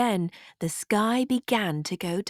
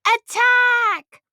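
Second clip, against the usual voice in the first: high, loud shout of attack; rally the troops with a cry; battle cry; 7 dB louder than usual. A narrator's reading. Prince calling his men to attack, loud battle cry. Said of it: "The prince cried".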